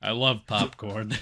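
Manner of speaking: a hint of a doddering old robot rambling; addled old Prime. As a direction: lightly play a muddled old leader who forgot the war.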